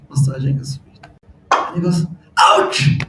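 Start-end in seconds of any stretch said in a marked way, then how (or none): 2.4-3.0 mock ouch. Lord cries out again.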